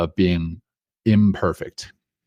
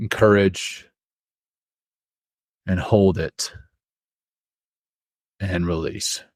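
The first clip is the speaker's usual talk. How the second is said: guided breathing. Acted. Leads breathing exercise.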